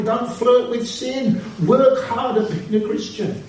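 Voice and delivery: high, ringing exhortation, pressing each line home; preaching at full force. Acted. Preacher urging students to serious faith, rising and insistent. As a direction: drive it home like a revival preacher.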